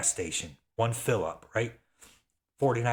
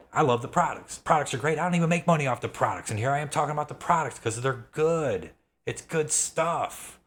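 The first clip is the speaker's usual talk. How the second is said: excited rant; 5 semitones higher than usual. Real, not acted. Selling viewers on the products, excited and worked up. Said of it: "Yes, this is my rant moment".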